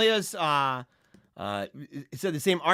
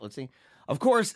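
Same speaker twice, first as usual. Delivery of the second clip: ordinary talk, nothing marked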